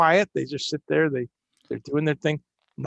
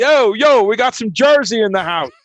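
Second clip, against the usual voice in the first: loud, hammed-up Jersey accent, pitched up; 4 semitones higher, 5 dB louder than usual. Shown as a demonstration. Greeting fans from Jersey in the chat, loud Jersey accent. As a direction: holler it out in a broad Jersey accent. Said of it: "I can do the accent now".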